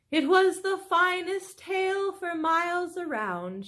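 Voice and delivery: soothing her husband between licks; Mrs Fox, tender. Acted. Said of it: "she said in between licks".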